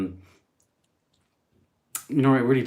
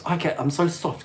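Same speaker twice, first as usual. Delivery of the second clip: normal talking voice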